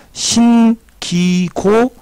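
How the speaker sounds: drawn-out teaching demo, held high; slow demonstration. Shown as a demonstration. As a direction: stretch out each syllable for students. Teacher slowly demonstrates verb forms.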